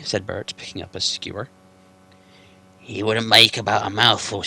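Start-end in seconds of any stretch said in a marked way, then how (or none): 2.8-4.4 gruff troll William. Acted. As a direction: bellow it like a dim-witted troll.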